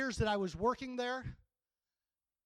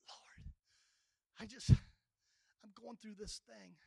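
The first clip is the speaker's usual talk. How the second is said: faint, halting prayer; subdued cry; much slower than usual. Acted. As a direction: murmur it haltingly, barely getting the words out. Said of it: "our cry could be".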